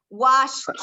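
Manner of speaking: "Washed" in one syllable ending in T, washt. Shown as a demonstration. Teacher checks everyone hears it, "Washed" said washt with final T. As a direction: release a crisp T after "Washed". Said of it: "escucha la T que estoy haciendo".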